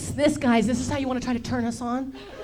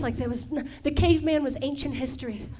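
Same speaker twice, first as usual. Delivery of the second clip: ordinary talk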